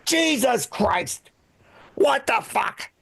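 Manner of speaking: lightly voiced exasperated old man; drawn-out grumbled curses, imitating father-in-law; grumpy father-in-law. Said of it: "he walks around the house going".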